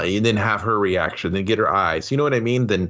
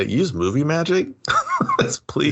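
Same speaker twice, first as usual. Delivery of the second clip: mock plea; 8 semitones higher than usual. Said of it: "It's just like".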